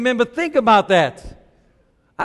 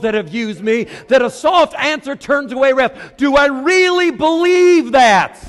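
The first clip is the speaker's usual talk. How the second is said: higher, rising preacher's build; preaching at full force; 4 semitones higher than usual. Real, not acted. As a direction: press each question harder on the congregation.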